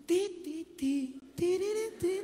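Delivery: soft sung "ti, ti" beeps; mock computer chime; imitated beeps. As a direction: hum it like a machine. Imitating computer startup sound.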